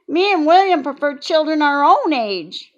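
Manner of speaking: put-on prim child stating her preference; Junie B voice. A narrator's reading.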